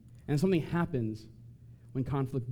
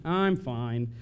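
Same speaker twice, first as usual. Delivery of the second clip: low sullen fine; 4 semitones lower than usual. Retold or imitated. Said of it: "we know you're lying".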